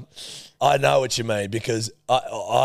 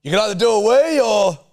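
high and loud; 12 semitones higher, 7 dB louder than usual. Real, not acted. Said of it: "Yell the other part".

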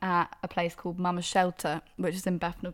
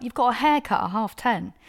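loud, raised, disbelieving repeat; indignant echo, higher and louder; incredulous echo; 5 semitones higher, 6 dB louder than usual. Retold or imitated. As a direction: repeat the excuse as if it's absurd. Repeats the excuse back in disbelief.

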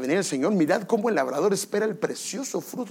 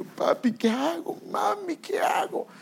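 very high, drawn-out pleading; mock tearful wail; 14 semitones higher, slower than usual. Married child back crying to parents, mock tearful wail. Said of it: "después llorando".

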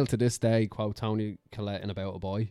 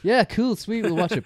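fake agreement; 10 semitones higher, 6 dB louder than usual.

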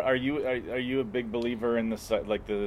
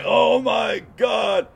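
slow, higher, loud drawn-out gasp of awe; mock awe shout; 9 semitones higher, 7 dB louder, much slower than usual. Parodying a tripper's epiphany, loud mock awe. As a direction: shout it like a revelation hit you. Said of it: "And you".

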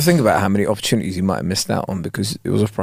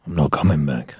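very low, quiet refusal; stoic man; 10 semitones lower, 6 dB quieter than usual. Acted. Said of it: "a very stoic man".

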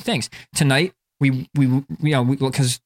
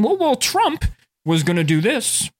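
mock older person. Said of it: "like, older people".

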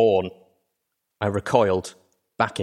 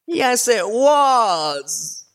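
very high, loud, slow wailing cry; anguished cry; 14 semitones higher, 6 dB louder, much slower than usual. Acted. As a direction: let it tear out as she breaks. Filly breaks down, admitting.

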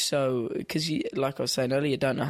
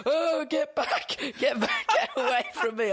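much higher, slow, laughing mock panic; mock terrified fans. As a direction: squeal like a fan recoiling from a bloody singer. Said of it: "They were like".

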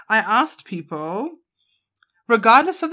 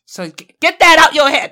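loud mock Boston accent; hammed-up Boston tough talk; 8 semitones higher, 12 dB louder than usual. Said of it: "I was trying to do a Boston accent".

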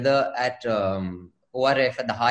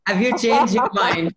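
laughing, louder teasing question; laughing tease; 4 semitones higher, 4 dB louder than usual. Real, not acted. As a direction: tease her through a laugh.

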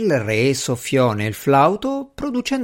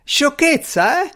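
indignant cry. A narrator's reading. Servant retorting to Strange's dismissal, indignant cry. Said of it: "gridò l'uomo".

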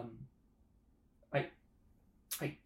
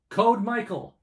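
shouting; 9 semitones higher, 35 dB louder, much slower than usual. Acted. Urgent code call.

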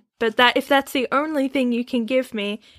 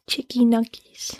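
tiny whispered treat name; whispering. Acted. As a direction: whisper it playfully. Whispered reply in kind.

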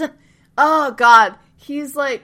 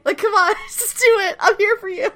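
urgent coaxing; 13 semitones higher than usual. Retold or imitated. Siler coaxing in retold scene.

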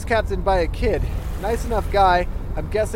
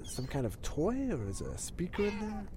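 barely audible; 13 dB quieter than usual. Real, not acted. Puzzled, sick, on bench.

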